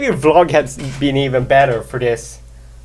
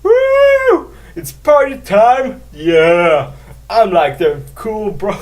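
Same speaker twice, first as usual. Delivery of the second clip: high, loud whoop settling into cool bro; mock party whoop; 5 semitones higher, 3 dB louder, slower than usual. Vlogger joking about vodka in orange juice, party bro. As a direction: whoop it up, then ease down. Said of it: "and be like".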